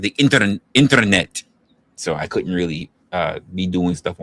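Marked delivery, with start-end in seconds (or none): 0.0-1.4 internet in a Russian accent. Shown as a demonstration. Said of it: "gotta do the whole, uh, Russian accent".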